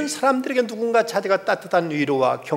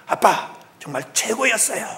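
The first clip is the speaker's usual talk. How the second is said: higher-pitched imitation of a child calling out; mock shout, pitched up like a proud child; child's loud cry; 6 semitones higher than usual. Child calls to singer father across the theatre, imitated loud cry. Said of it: "한 아이가 큰 소리로 외칩니다".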